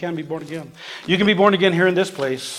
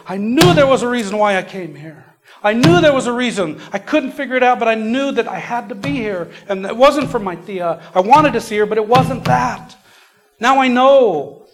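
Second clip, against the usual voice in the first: high, loud, impassioned retold testimony; emphatic outburst of discovery, imitating the convert; excited realization; 7 semitones higher, 6 dB louder than usual. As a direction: act out a breakthrough, voice raised high. Acting out new convert's joyful realization.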